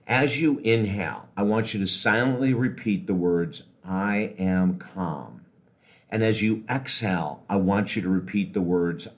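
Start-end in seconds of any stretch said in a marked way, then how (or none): 3.8-5.4 very slow, relaxed mantra; slow calm affirmation. Shown as a demonstration. Coach models the words to repeat on inhale, slow and calm. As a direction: say it slowly, settling down. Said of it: "do it very slowly".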